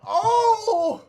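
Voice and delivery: long high loud "ohhh"; shocked "oh". Retold or imitated. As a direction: cry out a drawn-out, high-pitched oh. His shocked reaction, acted out.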